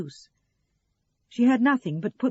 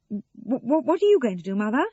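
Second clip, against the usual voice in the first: hesitant stammered start, then higher and louder; halting; 5 semitones higher, 7 dB louder than usual. A narrator's reading. Sarah asking after her mother's evening, halting. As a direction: stumble into the awkward question. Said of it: "Sarah asked haltingly".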